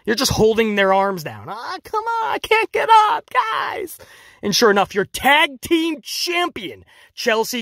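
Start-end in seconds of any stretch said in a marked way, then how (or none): 1.5-4.0 high, whiny mock helplessness; mock whiny wrestler. Vlogger mocking the pinned champion, high and whiny. 5.0-7.6 slow, high mock announcer; sarcastic announcement. Vlogger mocking the tag champion, slow and sarcastic.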